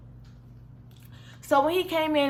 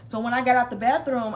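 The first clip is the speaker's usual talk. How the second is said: ordinary delivery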